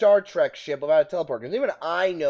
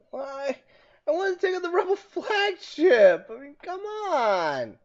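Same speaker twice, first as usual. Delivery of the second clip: high-pitched incredulous rant; climbing into a squeal of disbelief; exasperated outrage; 10 semitones higher than usual. Real, not acted. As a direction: protest it, voice pitched way up. Game crashed, outraged complaint.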